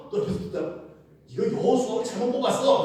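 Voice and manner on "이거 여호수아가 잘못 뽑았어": loud, high grumbling accusation; complaining shout. Acted. Pastor voicing a grumbling Israelite, loud and high. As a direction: blurt out the complaint against Joshua.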